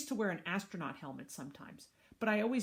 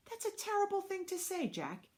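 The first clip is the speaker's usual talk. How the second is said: much higher, dismayed reproach; shocked scolding; 14 semitones higher than usual. Acted. Mom reproving Jack for a cruel remark, shocked scolding. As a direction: scold him in a high, appalled voice.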